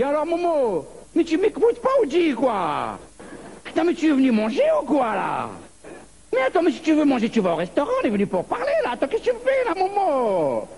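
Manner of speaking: high, pushy nonstop talker with a pied-noir lilt; pied-noir chatterbox. Acted. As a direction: bombard Momo without letting him answer. Pied-noir friend voiced talking nonstop.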